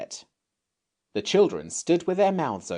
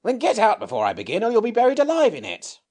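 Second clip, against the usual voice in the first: higher, fast, louder warning; fairy's warning; 12 semitones higher, 6 dB louder, faster than usual. Acted. Fairy warns children to leave, fast and urgent. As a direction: hurry them off with a threat.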